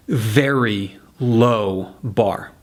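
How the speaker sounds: very slow, spaced "very ... low ... bar"; stress on very and low. Shown as a demonstration. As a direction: draw out "very" and "low" with pauses. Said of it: "put the emphasis on very and low".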